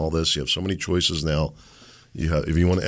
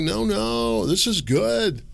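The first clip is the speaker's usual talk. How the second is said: high, insistent mock shout; mock swamp leadership; 11 semitones higher, slower than usual. Host mocks the leadership insisting we pay more, high. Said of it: "and why they're like".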